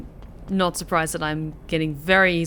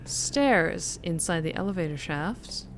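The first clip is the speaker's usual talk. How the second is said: put-on softer, practiced American accent; 3 semitones lower, 8 dB quieter than usual. Gamer puzzling out a broken elevator, soft American accent. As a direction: think aloud in an American accent. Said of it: "talking like this".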